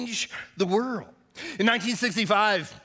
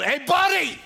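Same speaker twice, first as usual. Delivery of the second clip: high-pitched parrot call; parrot yell; 8 semitones higher, 3 dB louder than usual. Acted. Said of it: "the bird yelled".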